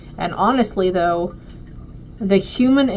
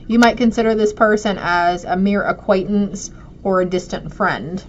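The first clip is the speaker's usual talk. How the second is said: normal talking voice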